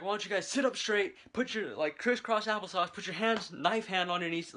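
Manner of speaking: mock-yell the Marine's commands at the recruits; retold yelling Marine. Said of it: "he's yelling at us".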